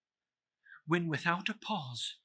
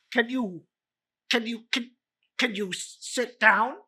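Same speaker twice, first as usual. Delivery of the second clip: nervous stammer; 7 semitones higher, 3 dB louder than usual. Acted. Scrooge stammers at ghost.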